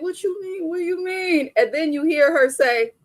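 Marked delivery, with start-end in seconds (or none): 0.0-1.5 husband's reply. Retold or imitated. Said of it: "And he's like".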